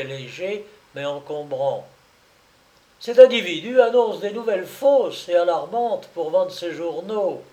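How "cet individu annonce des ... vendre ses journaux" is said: louder, higher, righteous complaint; indignant accusation. Acted. The client denounces the hawker to the policeman, indignant. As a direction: denounce him to the officer.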